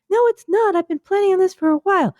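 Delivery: the first shoe fights for its turn; first shoe, bickering. Pretend. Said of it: "I'm a shoe".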